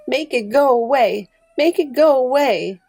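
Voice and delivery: put-on high, insistent toddler wail of words; frightened small child pleading. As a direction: plead loudly like a scared little child. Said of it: "she was saying over and over".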